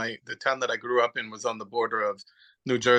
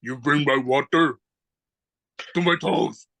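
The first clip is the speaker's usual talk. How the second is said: Tor Johnson impression; 3 dB louder than usual. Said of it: "he looks like Tor Johnson".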